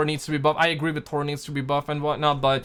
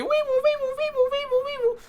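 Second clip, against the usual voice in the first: high siren wail imitation; siren sound. Building suspense before rating prediction.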